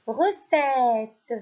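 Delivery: "mallette" projected clearly; teacher calling out "mallette"; loud dictation. Shown as a demonstration. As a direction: dictate "mallette" with a falling tune. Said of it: "Je répète".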